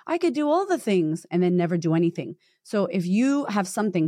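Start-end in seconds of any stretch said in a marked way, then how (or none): 0.1-1.3 high, airy mocking voice; mock indecisive. Coach mocking an indecisive person, high and airy. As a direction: lift the pitch to mock someone who never acts. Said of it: "We're not like".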